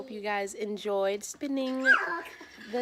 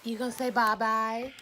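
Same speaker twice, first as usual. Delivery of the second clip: put-on high, sing-song talk to a baby; mother coaxing her baby to say bye-bye, sing-song; 7 semitones higher than usual. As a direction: coo at the little one, high and sweet. Talking to baby daughter.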